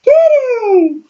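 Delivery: squealed high cat call; high-pitched call. Real, not acted. Calling to the cat.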